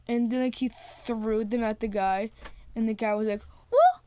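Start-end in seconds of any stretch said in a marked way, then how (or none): 3.7-4.0 very high, loud "woo"; quick pretend whoop as a blow misses; acted squeaky whoop; mock dodging whoop. Guy dodging thrown poles.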